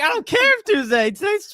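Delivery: high-pitched mock outburst; hammed-up retold frustration; exasperated. Retold argument with the server.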